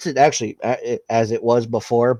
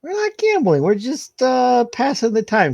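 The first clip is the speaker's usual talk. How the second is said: wheedling; 12 semitones higher, 3 dB louder than usual. Acted. Vinny talking Ron into it.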